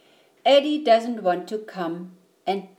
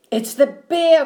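loud, higher alarmed cry; 7 semitones higher, 8 dB louder than usual. A narrator's reading. Eddie warns Mom about the bear, crying out. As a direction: burst out with the warning. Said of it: "cried Eddie".

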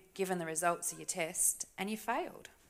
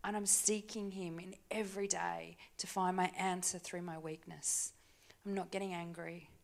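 ordinary talk

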